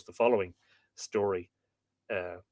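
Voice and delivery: ordinary delivery, nothing marked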